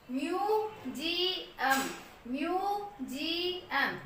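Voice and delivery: म्यूजियम very slow, long ई and ऊ; slow demo word. Shown as a demonstration. Teacher slowly models म्यूजियम with long vowels. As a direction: stretch both vowels of म्यूजियम. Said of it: "हम ई भी long बोल रहे हैं".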